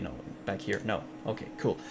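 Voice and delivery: normal talking voice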